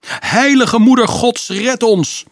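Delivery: high, loud, slow panicked cry; terrified plea. Acted. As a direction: beg God for rescue in terror. Terrified guards flee demons. (doodsbang hoog geroepen)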